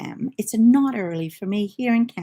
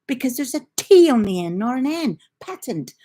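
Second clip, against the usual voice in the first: higher, emphatic mock-exasperated explaining; retold insistence; 5 semitones higher, 4 dB louder than usual. Mum argues spelling with her son, exasperated insistence. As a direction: re-enact arguing the point, hitting the key words. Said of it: "I said".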